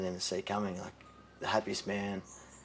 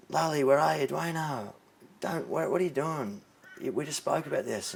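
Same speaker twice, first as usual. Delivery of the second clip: calling out; 6 semitones higher than usual. Retold or imitated. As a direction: shout for him through the house. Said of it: "I was".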